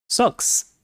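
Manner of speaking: Socks whispered softly; whispered gloss. Shown as a demonstration. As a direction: whisper the English word aside. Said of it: "which means socks".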